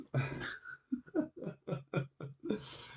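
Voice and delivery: long, breathy, voiceless chuckle; real laugh after a bit of banter. Real, not acted. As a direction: wheeze with amusement. Laughs at his own banter.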